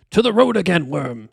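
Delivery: scornful wizard ordering a servant; lightly voiced haughty old wizard; Saruman. A narrator's reading. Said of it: "said Saruman".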